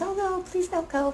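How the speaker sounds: high pleading mock whine; imitating the young guy. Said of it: "And he goes".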